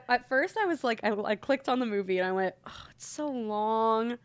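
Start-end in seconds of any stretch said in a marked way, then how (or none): 2.7-4.2 dismayed. Retold or imitated. Retelling her reaction to the movie's length.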